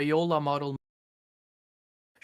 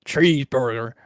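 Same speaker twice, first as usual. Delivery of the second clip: mock American accent; 3 semitones lower, 5 dB louder than usual. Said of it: "most people, when they do American accents".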